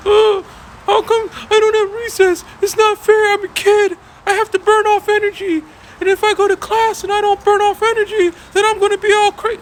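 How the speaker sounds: put-on very high, loud whiny child voice; bratty student pleading for recess; whiny kid. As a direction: whine and protest like a cheated kid. Student whining about recess.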